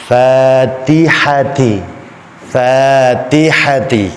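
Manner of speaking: FATIHATI slow with light "æ" vowels; thin, smiling. Shown as a demonstration. Teacher correcting the thick reading, thin Fatihati. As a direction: draw out Fatihati with spread lips. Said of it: "Fa tipis, ta tipis".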